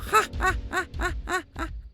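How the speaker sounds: high, staccato menacing cackle; evil ghost cackle. Acted. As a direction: cackle high in long evenly spaced bursts. Ghost laughs after threatening village. (ऊँची, डरावनी भूतिया हँसी)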